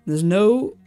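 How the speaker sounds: usual speaking voice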